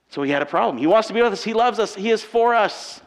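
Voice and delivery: usual speaking voice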